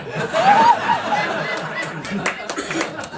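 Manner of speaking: squealing high laughter. Real, not acted. Comic telling the crowd about the kid, squealing with laughter.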